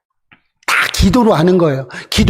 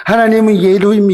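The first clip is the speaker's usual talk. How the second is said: usual speaking voice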